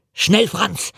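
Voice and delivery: urgent call. A narrator's reading. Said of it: "rief eine Stimme auf Deutsch".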